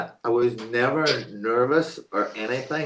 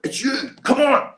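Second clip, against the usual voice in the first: high, loud mock shout; urgent summons; 13 semitones higher, 6 dB louder than usual. Assistant director spotting his man, shouting urgently. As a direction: imitate an urgent call to hurry over. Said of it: "He goes".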